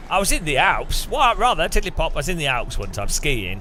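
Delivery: posh British caricature; hammed-up posh English. Shown as a demonstration. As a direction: put on a silly posh toff voice. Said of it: "I sound well posh".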